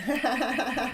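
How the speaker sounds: real laugh at her own slip; quick laugh. Real, not acted. Laughs at forgetting a number.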